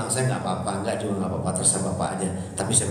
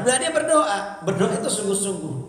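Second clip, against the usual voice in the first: preacher stressing earnest prayer, high and emphatic; 9 semitones higher than usual. Acted. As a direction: drive the point home, pitched way up.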